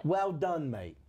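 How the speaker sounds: mock-Cockney catchphrase, "mate" dropping away; comic English accent, falling at the end. Comedian in ghost act praising co-host, English accent. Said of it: "It's Cockney-ish".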